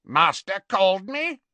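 a resentful servant bowing with a croak; croaking Kreacher. Acted. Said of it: "croaked Creature".

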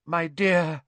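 high, slow, dramatic seer; swooning fortune teller; mystic seer voice. Acted. Trelawney voice.

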